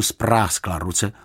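plain reading voice

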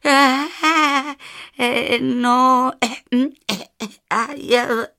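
high, stammering jackal; halting, high-voiced reluctance; hesitant jackal. A narrator's reading. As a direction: stall nervously with drawn-out ums, pitched high. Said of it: "začal váhavě šakal".

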